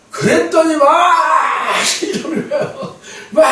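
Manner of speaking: bursts into a high, loud laugh; real laugh while telling it. Real, not acted. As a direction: laugh it out in delight. Laughs recalling his body shaking.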